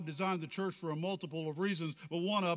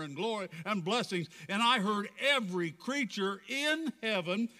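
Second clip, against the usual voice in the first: raised, projected preacher's reading; fervent scripture recitation; 6 semitones higher, 4 dB louder than usual. Acted. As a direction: declaim the scripture to a whole congregation. Preacher recites scripture at full force.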